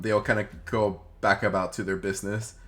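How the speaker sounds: normal talking voice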